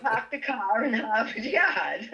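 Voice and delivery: slow laughing Boston accent, Park the car in Harvard Yard with no r sounds. Shown as a demonstration. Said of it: "worse Boston accent".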